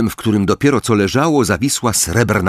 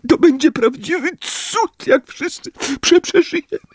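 high, stammering, sobbing worries; a frightened mother pouring out her fears; crying, much higher, words breaking; tearful outpouring. Acted. Molly crying, tears flowing.

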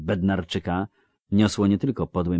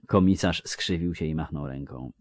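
ordinary delivery, nothing marked

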